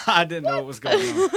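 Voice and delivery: laughing. Real, not acted. Laughing after a quick guess.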